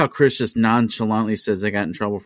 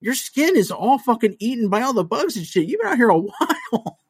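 much higher, incredulous rant; exasperated disbelief. Retold or imitated. To the drunk neighbor: much higher, exasperated disbelief. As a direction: pitch it way up in disbelief at her.

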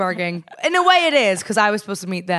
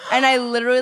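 ordinary talk

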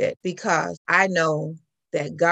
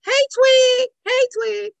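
loud, high greeting call; imitated neighborly shout, pitched high; passers-by hollering; 7 dB louder, slower than usual. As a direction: holler a nickname from the street. Passers-by calling out to the child.

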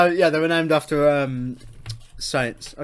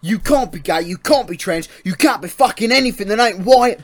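loud, high, hammed-up English lout accent; proper English accent; 4 semitones higher, 6 dB louder than usual. Co-host lecturing "son" on the flag, hammed-up English accent. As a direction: rant like a mock flag-waving English bloke. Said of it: "a fucking proper English accent".

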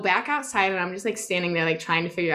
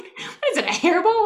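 exasperated yell; 9 semitones higher, 4 dB louder than usual. Retold or imitated. Exasperated retold yell at dog.

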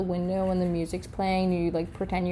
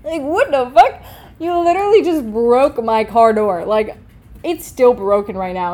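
louder outraged rant about the car; loud, fed-up venting; outraged outburst; 3 semitones higher, 9 dB louder than usual. Real, not acted. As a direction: blow up in disbelief. Ranting about broken car door.

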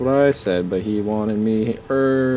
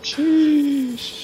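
high squealed sheesh; 14 semitones higher than usual. Pretend. Trading exaggerated sheesh calls.

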